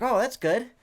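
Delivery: high, loud, automatic cheerful reply; chirpy service reply. Retold or imitated. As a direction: sing it out like a cashier who didn't listen. Mishears, answers cheerfully.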